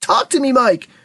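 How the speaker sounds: high, pleading call; anxious cry. A narrator's reading. Mother begging son to speak, crying out. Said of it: "cried Missus T V".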